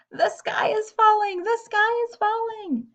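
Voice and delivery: high frantic chorus cry; panicked screaming. Acted. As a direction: shriek it as the whole flock runs. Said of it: "screamed as they ran".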